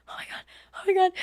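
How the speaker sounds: whispered, then soft and high; hushed disbelief. Retold or imitated. Girlfriend just before being proposed to, retold hushed panic. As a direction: gasp it under your breath, reenacting nerves.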